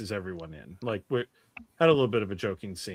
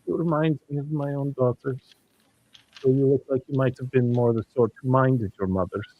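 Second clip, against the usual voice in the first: lightly voiced matron, higher; innkeeper matron; 5 semitones higher, 4 dB louder than usual. Innkeeper appraising the young guests, lightly voiced matron. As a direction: size them up in a higher character voice. Game master plays innkeeper.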